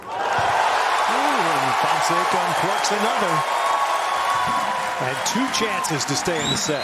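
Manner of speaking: loud, rising play-by-play call; excited call. Real, not acted. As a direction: call the winner with a burst. Big point, live call.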